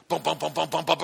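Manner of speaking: mock heartbeat thumps, bump bump; imitated heartbeat. Pastor acting out his caffeine-fast heartbeat, imitated thumps. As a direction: tap out a racing pulse with your voice. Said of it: "I feel like a machine gun".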